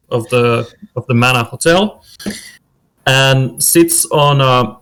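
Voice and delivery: usual speaking voice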